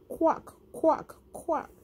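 squeaky high "quack"; high duck quacks. Shown as a demonstration. Teacher voicing the duck for children, high quacking.

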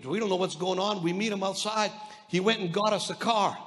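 ordinary delivery

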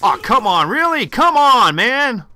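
loud, high, outraged come on; real outrage, loud and high; exasperated shout. Real, not acted. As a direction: yell in disbelief at the game. Enemy dodges his bullets.

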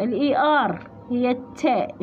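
ordinary delivery

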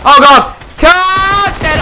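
loud panicked yell; panicked shout. Real, not acted. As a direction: shout in alarm. Dying in fight.

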